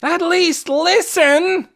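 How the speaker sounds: very slow, high, loud desperate shout; shout at top of lungs. A narrator's reading. Dune on a bench shouting at Ben with all his lungs. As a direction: yell with everything you have. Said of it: "shouted at the top of his lungs".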